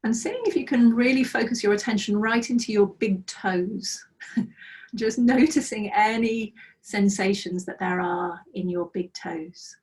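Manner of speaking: calm, unhurried meditation guide voice; guided meditation. Acted. Teacher guiding students through a breathing practice, calm meditation voice. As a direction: lead the students gently into body awareness.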